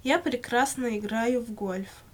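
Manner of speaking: ordinary delivery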